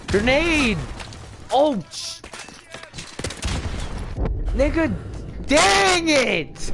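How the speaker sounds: high, loud yell mid-game; alarmed shout. Real, not acted. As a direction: shout out in panic and frustration. Sudden grenade during live game.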